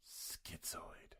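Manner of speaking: breathless trailer whisper of the title. Acted. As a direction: drop to a whisper for the movie name. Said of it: "trailer announcer voice".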